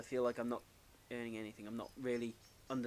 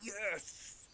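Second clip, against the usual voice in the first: high, drawn-out celebratory yes; mock triumph; 7 semitones higher, 4 dB quieter than usual. Game character getting the answer right, triumphant. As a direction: play a restrained little victory cry.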